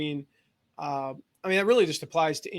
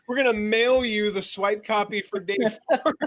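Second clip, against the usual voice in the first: laughing; 5 semitones higher, 5 dB louder than usual. Retold or imitated. Mocking overcomplicated affiliate instructions.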